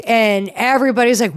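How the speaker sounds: normal talking voice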